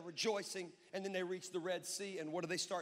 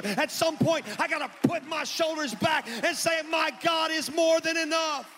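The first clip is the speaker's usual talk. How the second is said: high shouted exhortation, building louder; screamy preaching; 6 semitones higher, 11 dB louder than usual. Real, not acted. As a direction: build to a full-throated revival scream. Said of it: "Sometimes I get screamy".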